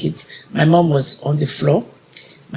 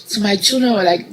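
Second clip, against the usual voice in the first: ordinary talk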